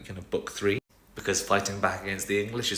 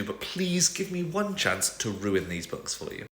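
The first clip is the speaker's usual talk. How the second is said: ordinary talk, nothing marked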